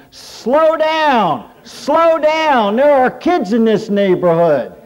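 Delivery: high, loud alarmed shout; yelling old man. Acted. Elderly man with a cane yells at a parked car, high urgent yelling. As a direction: yell a warning across the street. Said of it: "starts yelling".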